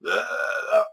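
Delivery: high, drawn-out slobbering "ugh"; mock tongue noise. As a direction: imitate a sloppy tongue kiss. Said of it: "you don't see any of them go".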